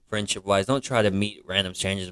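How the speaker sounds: normal talking voice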